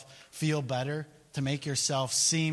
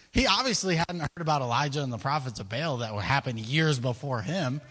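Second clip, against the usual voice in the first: normal talking voice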